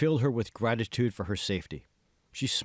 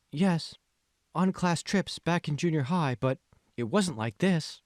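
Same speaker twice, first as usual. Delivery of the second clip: lightly voiced young woman, higher; Megan replying; 7 semitones higher than usual. A narrator's reading. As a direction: answer a little unsure, higher pitched.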